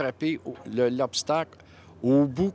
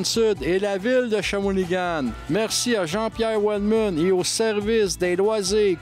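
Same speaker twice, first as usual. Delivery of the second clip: sponsor announcement; 4 semitones higher than usual. Acted. Reads the sponsor message.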